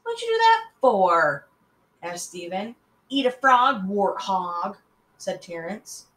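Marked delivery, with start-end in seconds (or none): 0.0-1.5 high loud indignant cry; boy upset his ball went over the fence. Acted. Upset question after kick. 3.1-4.8 taunting. Acted. Taunting insult.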